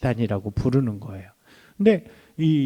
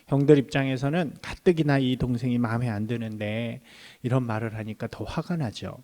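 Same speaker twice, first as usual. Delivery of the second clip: normal talking voice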